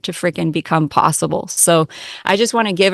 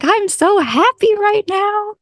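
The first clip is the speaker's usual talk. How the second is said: much higher and slower, imitating the client's glee; giddy happy quote; 14 semitones higher, slower than usual. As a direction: squeal it out like pure delight. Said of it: "she messaged me later and she's like".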